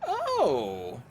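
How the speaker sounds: ordinary talk, nothing marked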